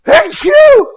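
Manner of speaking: loud sudden sneeze; sneezing. Real, not acted. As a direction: let out a big real sneeze. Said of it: "I have to sneeze".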